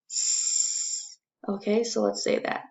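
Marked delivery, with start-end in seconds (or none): 0.1-1.2 hissed s sound; a long hissing s; letter sound s. Shown as a demonstration. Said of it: "S S just says".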